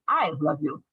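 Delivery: "I" carries the stress, "love you" falls low. Shown as a demonstration. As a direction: lean hard on "I". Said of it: "you stress on the word "I".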